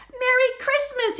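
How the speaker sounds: turkey popping out with a happy shout; joyful cry. Acted. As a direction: burst out of the box cheering. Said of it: "he cried".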